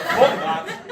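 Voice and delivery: high, loud disgusted blurt; mock-outraged drunk; scoffing outburst. Rejecting the Birmingham girls.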